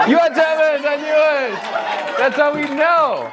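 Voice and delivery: high, hammed-up accusing cry; triumphant accusation. Comic exposing three unlaughing women as Germans, triumphant. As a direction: pounce on them in mock triumph, very high.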